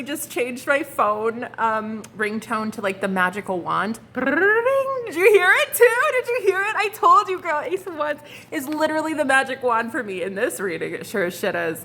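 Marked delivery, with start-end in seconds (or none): 4.1-10.9 high, loud amazed squeal; giddy excitement. Real, not acted. Reader asking viewers if they heard it, giddy. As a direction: marvel at the sign. Ringtone sound confirms the reading.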